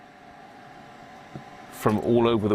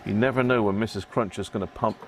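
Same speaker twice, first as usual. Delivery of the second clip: usual speaking voice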